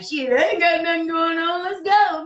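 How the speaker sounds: high, carefree quote of herself; tossed-off high "let's go"; playful high bravado; mock bold reply. Acting her bold response.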